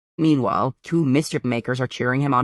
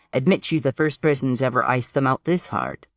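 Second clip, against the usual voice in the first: usual speaking voice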